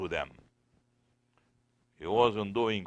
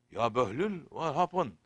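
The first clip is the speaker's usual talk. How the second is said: higher-pitched, mildly startled question; concerned surprise; 6 semitones higher, 4 dB louder than usual. Retold or imitated. Khalifa to a crying Behlul, mildly startled concern. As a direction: ask with a touch of alarm.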